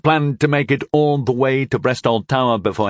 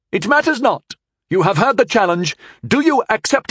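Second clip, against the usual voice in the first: put-on haughty young knight; 12 semitones higher, 4 dB louder than usual.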